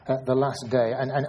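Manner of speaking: normal talking voice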